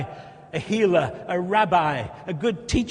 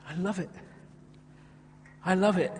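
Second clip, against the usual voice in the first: usual speaking voice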